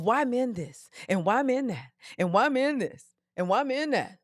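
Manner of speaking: repetitive sing-song whine in imitation; mocking complaint. Host mimicking ladies' messages about men, mocking sing-song. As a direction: mimic a string of complaints, rising and falling each time.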